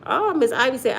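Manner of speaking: ordinary delivery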